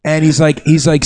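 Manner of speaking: ordinary talk, nothing marked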